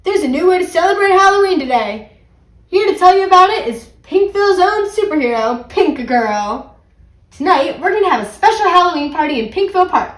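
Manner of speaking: high, loud, showy broadcast; excited announcer voice; radio announcement. A narrator's reading. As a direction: proclaim the big news to the town.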